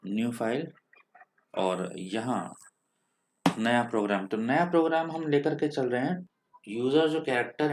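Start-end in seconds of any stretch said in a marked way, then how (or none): none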